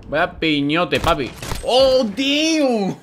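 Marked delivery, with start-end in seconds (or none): 1.6-3.0 high, slow, stretched-out cry; hyped shout. Real, not acted. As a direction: yell it out in delight. Hyped reaction to a play.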